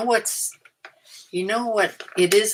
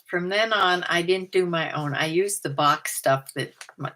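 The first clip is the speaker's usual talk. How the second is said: usual speaking voice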